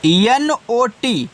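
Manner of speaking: slow, careful spelling of P L E A S E; letters spelled out one by one, slowly. Shown as a demonstration. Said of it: "spelling letters".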